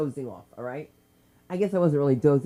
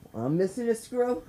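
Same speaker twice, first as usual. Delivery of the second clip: higher, rising disbelief; incredulous echo; 5 semitones higher than usual. Retold or imitated. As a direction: repeat it back, can't believe it.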